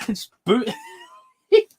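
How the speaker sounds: ordinary talk, nothing marked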